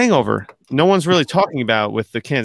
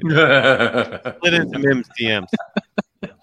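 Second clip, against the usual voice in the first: laughing; 2 semitones lower, much slower than usual. Real, not acted. Teasing about unanswered DMs.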